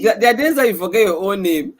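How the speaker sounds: talking loudly through real laughter; laughing. Real, not acted. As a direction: laugh it out as you tease a friend. Teasing co-host's slip amid laughter.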